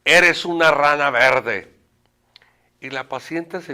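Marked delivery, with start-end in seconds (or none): 0.1-1.7 slow, forceful shouted insult; re-enacted full-voice shout; loud shout. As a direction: yell it out word by drawn-out word. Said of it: "Y le grito fuerte".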